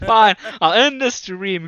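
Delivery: loud, slow, grudging give-in; exasperated. Real, not acted. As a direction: drag it out, mock defeated. Told to end stream.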